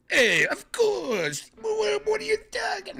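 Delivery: very high, loud, stretched-out mock reply; exaggerated joke voice; hammed-up put-on voice. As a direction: squeal it as a goofy caricature. Joke impression, then "just kidding.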